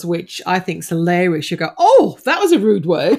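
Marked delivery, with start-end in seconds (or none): 1.8-3.2 mock-scandalised, higher and louder; prim shock at hearing bad language; hammed-up "oh" of disapproval; imitating Sandy. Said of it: "the other one that she does".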